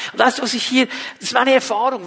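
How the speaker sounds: ordinary talk, nothing marked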